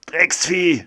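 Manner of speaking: high, loud curse yelled at the snake; angry shout. Acted. Doctor yells at the snake climbing his foot, furious. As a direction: spit the curse out as you stomp. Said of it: "schreit der Arzt".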